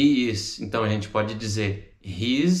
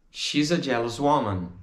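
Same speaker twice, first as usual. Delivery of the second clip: "She's a jealous woman" in one linked run; joined up. Shown as a demonstration. Teacher repeats the answer, words joined. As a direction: link "She's a" and carry on without gaps.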